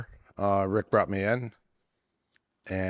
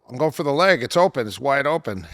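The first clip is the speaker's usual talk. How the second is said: louder and higher, defending his move; insistent protest; 6 semitones higher, 6 dB louder, faster than usual. Retold or imitated. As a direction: argue it's obviously open, voice up. He defends his leg attack.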